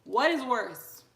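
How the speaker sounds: normal talking voice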